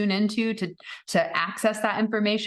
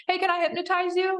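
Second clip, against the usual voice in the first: high playful mock voice; lightly imitating his question; husband asking; 12 semitones higher than usual. Husband on early date asking, high playful voice. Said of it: "he said".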